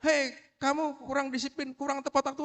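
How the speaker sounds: high-pitched reprimand; mock a teacher ticking off a pupil; scolding teacher. Teacher to an undisciplined pupil, scolding. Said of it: "Bu Rini nih kalau ada anak telat".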